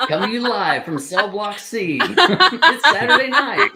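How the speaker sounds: playful show opening broken up by giggles; laughing announcer parody. Pretend. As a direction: announce it while cracking up. Parodies live show opening, cracking up.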